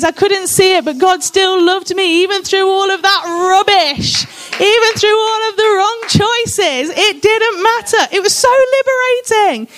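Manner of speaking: can't hold back the joy of being loved; overcome with excitement. Real, not acted. Preacher testifying to her church, overwhelmed with excitement. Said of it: "Ooh, I'm excited. Sorry".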